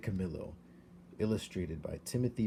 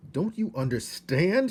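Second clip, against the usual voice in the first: high, loud frustrated shout; a husband shouting at his dismissive wife; 10 semitones higher, 5 dB louder than usual. A narrator's reading. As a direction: yell at her to get it. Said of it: "shouted Lester".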